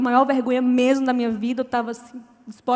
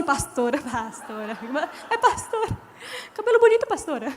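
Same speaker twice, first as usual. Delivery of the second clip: laughing stall; 6 dB quieter than usual. Retold or imitated.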